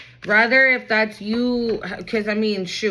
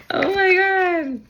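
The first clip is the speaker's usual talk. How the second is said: much higher, slow and delighted; overjoyed squeal; 8 semitones higher, slower than usual. Real, not acted. Overjoyed by the reading.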